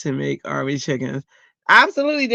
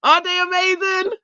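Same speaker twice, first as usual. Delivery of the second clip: very high, louder squeal; overjoyed squeal; 3 dB louder than usual. Real, not acted. Showing her stickers to chat, squealing. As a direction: squeal it out near tears of joy. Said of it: "I almost wanted to cry".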